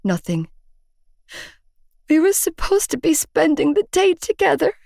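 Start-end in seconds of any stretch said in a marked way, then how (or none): 2.1-4.7 put-on high, tearful plea to her boyfriend; crying. Girlfriend crying to her collapsed boyfriend, tearful. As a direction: voice straining with tears. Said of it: "I cried".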